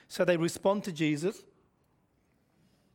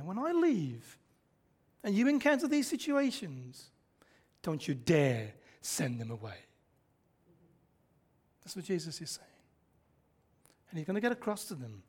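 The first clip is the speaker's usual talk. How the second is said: ordinary talk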